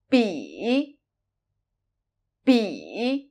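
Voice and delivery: "Bi" starting very low in the voice, dipping then rising; low dipping third tone on "Bi". Shown as a demonstration. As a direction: begin "Bi" at the bottom of the range and dip. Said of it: "start from a very low end of the vocal range".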